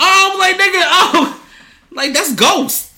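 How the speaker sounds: high, loud and laughing; shocked laughing outburst; laughing startled shout. Real, not acted. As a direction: yelp through laughter at the noise. Startled by heavy breathing.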